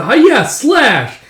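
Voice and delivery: put-on high, loud kid's battle cry; play-fight cry. Kids playing pretend with swords, play-fight cry. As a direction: yell it like a child swinging a toy sword. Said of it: "are all playing pretend".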